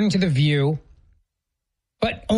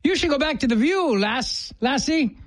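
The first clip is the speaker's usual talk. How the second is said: higher-pitched mock Irish lilt; bad Irish accent; 7 semitones higher than usual. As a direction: play at a clumsy Irish accent. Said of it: "I don't do an Irish accent".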